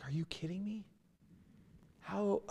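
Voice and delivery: whispered astonishment at a shocking remark; stunned whisper. Retold or imitated. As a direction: breathe it out in shock. Aghast at a churchgoer's statement.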